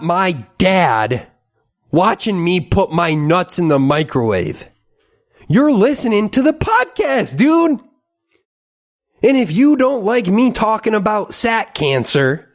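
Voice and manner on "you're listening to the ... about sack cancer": much higher, a lightly voiced South Park dad; Randy Marsh impression. Podcaster doing Randy Marsh at his listeners, high cartoon voice. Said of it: "Randy Marsh, South Park".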